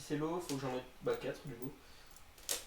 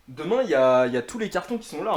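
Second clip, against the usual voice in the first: exasperated; 11 dB louder than usual. Real, not acted. Stressed about the mess.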